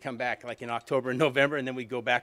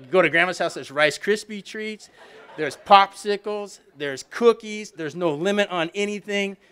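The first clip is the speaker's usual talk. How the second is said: higher, slow, savoring each treat; excited; 6 semitones higher, 6 dB louder, slower than usual. Real, not acted. Remembering Grandma's treats for the audience, excited and slow. Kid's delight at Grandma's house.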